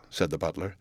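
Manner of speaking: plain reading voice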